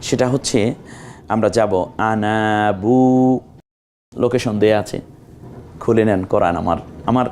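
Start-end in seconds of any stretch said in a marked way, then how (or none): 2.0-3.4 "আনাবু" with slowly drawn-out vowels; slow, held "আনাবু"; elongated vowels. Shown as a demonstration. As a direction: stretch the long vowels in "আনাবু". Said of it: "দ্বিতীয় আনাটা কোথায়?".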